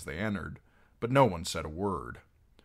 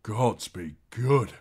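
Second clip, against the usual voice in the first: slow, louder, choked with feeling; 3 semitones higher, 5 dB louder, slower than usual. A narrator's reading. Harwin recognizing Arya, slow choked voice. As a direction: force it out past a tight throat. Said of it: "he said in a choked voice".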